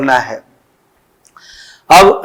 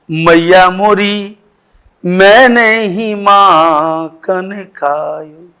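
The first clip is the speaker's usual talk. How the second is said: slow, drawn-out verse line; Krishna की तान; much slower than usual. Acted. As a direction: stretch every word out like a devotional verse. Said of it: "तो कृष्ण ने कहा". (कृष्ण की तरह खींच-खींचकर धीमे बोलें)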